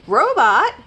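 loud high call, rising; shouting. A narrator's reading. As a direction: shout the name to get attention. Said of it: "shouted Rabbit".

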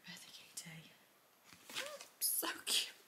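soft unvoiced whisper. Real, not acted. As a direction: breathe the words to yourself. Reading card softly to herself.

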